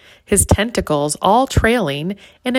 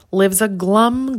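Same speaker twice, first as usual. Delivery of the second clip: ordinary delivery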